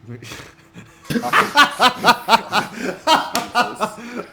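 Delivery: bursting out laughing loudly; big, loud, open laugh. Real, not acted. Big laugh over the radio.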